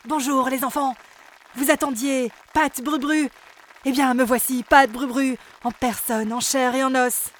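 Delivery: loud, boastful pop star voice; vain pop star. Acted. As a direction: show off like a conceited star. Vain elf singer greets children.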